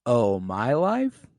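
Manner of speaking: slow, soft, drawn-out relief; relieved, unbothered. Retold or imitated. Daenerys hearing it's not her life, slow relieved. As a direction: let it out slowly, almost glad. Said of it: "I took it as a".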